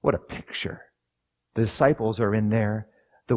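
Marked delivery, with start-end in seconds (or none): 0.0-0.8 whispered awe. Real, not acted. Awed aside.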